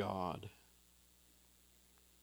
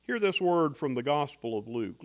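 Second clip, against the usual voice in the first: ordinary talk, nothing marked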